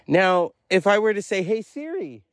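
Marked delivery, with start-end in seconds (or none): 1.4-2.2 telekinetic summons. Pretend. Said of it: "in a very, um, telekinesis way".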